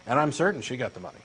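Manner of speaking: ordinary talk, nothing marked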